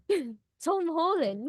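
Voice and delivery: slow drawn British Tom Holland; Tom Holland accent. Shown as a demonstration. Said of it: "All right, Tom Holland, and go".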